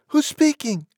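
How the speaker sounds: put-on very high falsetto girl on the phone; extremely high, chatty phone voice. A narrator's reading. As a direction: answer in a piping, sociable falsetto. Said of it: "she said".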